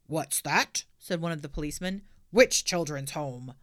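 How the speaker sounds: puzzled constable's voice; london policeman. Acted. Policeman questions Pippi.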